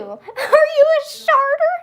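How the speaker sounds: high, squeaky, slow laughing question; loud squeal of laughter; cracking up. Real, not acted. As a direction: read it out through helpless giggles. Reads silly chat question, breaking up.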